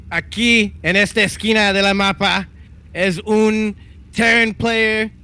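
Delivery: high, slow Spanish announcer voice; lucha libre announcer. Acted. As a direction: call the map like a wrestling announcer in Spanish. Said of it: "should we go in Spanish this one".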